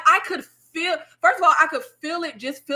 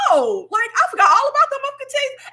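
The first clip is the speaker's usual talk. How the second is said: excited sing-song quote; 12 semitones higher, 3 dB louder than usual. Retold or imitated. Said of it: "was like".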